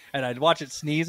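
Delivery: usual speaking voice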